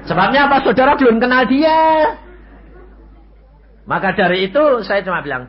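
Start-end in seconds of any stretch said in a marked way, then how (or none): 0.0-2.1 higher, louder challenge to the crowd; preacher raising his voice at the congregation; preaching emphasis. Acted. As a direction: press the point home from the pulpit.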